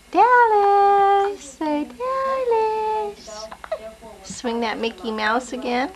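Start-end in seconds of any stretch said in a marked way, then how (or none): 0.1-3.5 high singsong coaxing, drawn out slowly. Real, not acted. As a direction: sing her name up high to coax her. Coaxing baby to talk.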